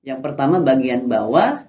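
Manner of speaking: ordinary talk, nothing marked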